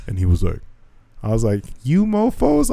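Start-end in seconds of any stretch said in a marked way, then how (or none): 1.8-2.7 high-pitched mock telling-off; comic exasperated reproach; retold scolding.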